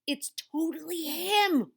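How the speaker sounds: plain reading voice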